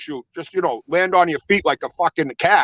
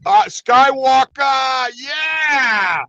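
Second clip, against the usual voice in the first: slow, high shouted hype of Skywalker; drawn-out hype shout; 8 semitones higher, much slower than usual. Real, not acted. Host shouting out Skywalker to listeners, slow hyped shout. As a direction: call his name out like a ring announcer. Hyping up a fighter.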